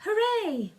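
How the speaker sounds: high, loud hooray; cheer. A narrator's reading. The crowd cheering for the creatures, high and loud. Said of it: "a dozen people cried".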